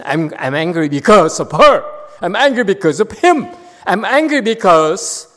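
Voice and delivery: loud, high acted tirade; angry blaming. Acting out temptation to blame others.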